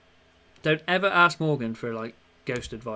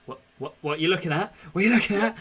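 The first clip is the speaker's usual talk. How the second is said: spooked; 4 semitones higher, 5 dB louder, faster than usual. Retold or imitated. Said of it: "You're like".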